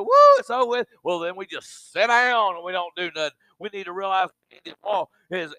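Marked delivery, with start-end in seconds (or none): 0.0-0.9 high, loud mock cheer that it's over; mock relieved whoop. Preacher mimicking churchgoers cheering the storm's end, mock whoop. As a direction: ham up the relief like the danger's gone.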